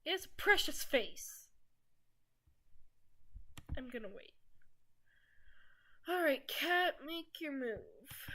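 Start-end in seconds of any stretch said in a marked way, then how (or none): 0.0-1.5 high, loud squeal over a cute face; excited gushing. Real, not acted. 6.1-8.3 slow hammed-up Western standoff drawl; exaggerated movie gunslinger. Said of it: "exaggerated voice of a movie gunslinger".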